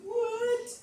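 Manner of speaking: high, loud cry of disbelief; a wailing What that cracks with shock. Retold or imitated. Stunned by a five dollar Jeep, crying out high and loud.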